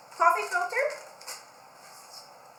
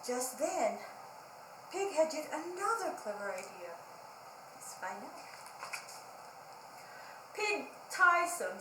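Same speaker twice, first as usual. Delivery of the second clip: put-on lively storybook read-aloud for kids; reading to children; 2 semitones higher, 4 dB louder than usual. A narrator's reading. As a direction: read it like library story time, bright and engaged. Reads picture book to children.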